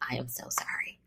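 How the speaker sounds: soft unvoiced whisper; hushed sorry to her husband; whispered apology. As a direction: breathe the apology, barely voiced.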